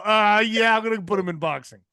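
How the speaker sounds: lightly voiced dad deciding for his kid; dad's reaction. Dad deciding on his Pee Wee-mimicking kid, lightly mocked. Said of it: "My dad's all".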